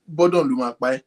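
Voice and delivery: ordinary talk, nothing marked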